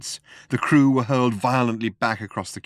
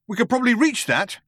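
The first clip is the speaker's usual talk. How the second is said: high, urgent voice; pitched-up, agitated man; frantic suggestion; 12 semitones higher than usual. A narrator's reading. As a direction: plead for it as time runs out. Desperate idea before impact.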